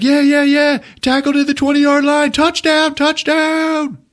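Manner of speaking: high, excited mock play-by-play; cheesy sportscaster call; mock announcer. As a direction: call the touchdown like a hyped commentator.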